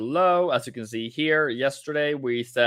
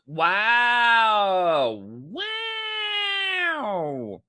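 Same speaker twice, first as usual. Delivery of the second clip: long gliding "wow"; drawn-out wow; 8 semitones higher than usual. Real, not acted. Streamer reacting to the numbers, drawn-out wow. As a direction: stretch out an amazed wow.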